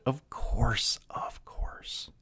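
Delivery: soft conspiratorial whisper fading out; of course whispered to nothing; whispered aside. Pretend. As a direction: breathe it as a knowing aside. Whispered aside after punchline.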